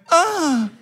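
very high mock yelp; high yelp without extra volume; imitated cry. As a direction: mimic a friend's sudden cry of pain. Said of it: "lo único que yo, eh, escucho es".

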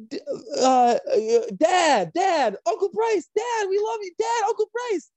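put-on high-pitched, eager child-like pleading; excited kids calling Dad. Wrestlers playing his kids run up to Dad, excited high voice. Acting out the kids greeting Bryce.

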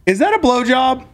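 high incredulous question; surprised. Real, not acted. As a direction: pitch it up in disbelief.

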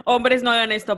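high, loud, laughing plea. Real, not acted. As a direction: blurt it out through laughter. Laughing reaction to trauma joke.

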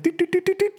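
very high light doot doot sound; fish noise. Retold or imitated. Describing fish rising to the boat, high playful doots. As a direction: squeak out little fish rising.

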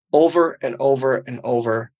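ordinary delivery

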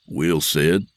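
plain reading voice

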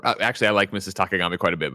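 normal talking voice

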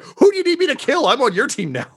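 high, loud eager offer; pumped to fight for you; eager henchman. Acted. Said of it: "He's like".

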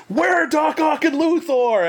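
high, slow hero voice; lightly voiced superhero demand; Spider-Man line. Actor retells Spider-Man questioning his henchman, mock heroic.

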